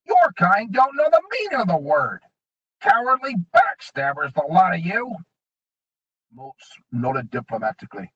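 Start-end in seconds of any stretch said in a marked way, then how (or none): 0.0-5.1 goblin contempt. A narrator's reading. Goblin insults dwarves.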